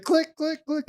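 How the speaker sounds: high, clipped mouth clicks of an empty gun; imitated gun click. Mimicking the empty gun for a co-host, high clicks. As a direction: pop each click up high like a dry trigger. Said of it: "And it's just like".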